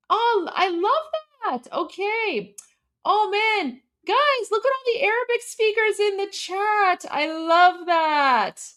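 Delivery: high-pitched, drawn-out delight; delighted gushing. Real, not acted. Streamer spotting Arabic speakers in the chat, delighted gushing. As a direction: gush fondly, voice lifted high.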